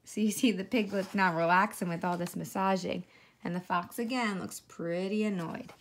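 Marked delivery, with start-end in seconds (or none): none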